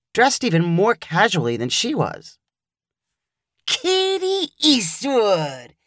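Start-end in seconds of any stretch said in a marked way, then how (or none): 3.7-5.7 Luke's voice, slow and drawn out; blurted-out name. Acted. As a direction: blurt it out in a slow, odd guy voice. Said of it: "he blurted out".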